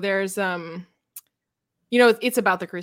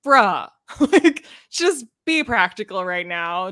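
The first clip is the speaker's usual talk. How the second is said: exasperated bruh; 3 semitones higher, 6 dB louder, slower than usual. Retold or imitated. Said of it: "And you're like".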